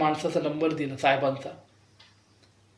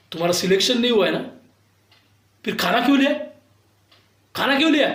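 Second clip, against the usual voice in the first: retold scolding coordinator, higher and louder; mock scolding; 5 semitones higher, 4 dB louder, faster than usual. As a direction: snap at him like a rude coordinator.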